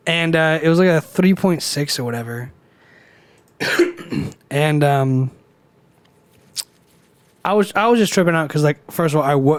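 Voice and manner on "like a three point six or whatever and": usual speaking voice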